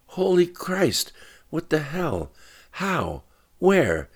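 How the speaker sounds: high, slow shocked outburst. Acted. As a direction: reel at the terrible news. Shocked news of Katie shot.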